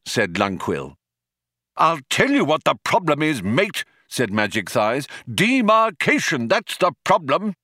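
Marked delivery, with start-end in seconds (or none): 1.8-3.8 high, emphatic shop-steward complaint; union-man philosopher, indignant. A narrator's reading. Magic Thighs as union man. 5.3-7.5 high, emphatic shop-steward complaint; union-man philosopher, indignant. A narrator's reading. Magic Thighs as union man.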